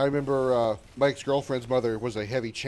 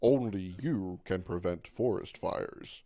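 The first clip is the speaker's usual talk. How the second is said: low, slow public service bear; deep slow mascot voice; Smokey the Bear; 5 semitones lower, 3 dB quieter, much slower than usual. Retold or imitated. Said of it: "Sound more like Smokey the Bear".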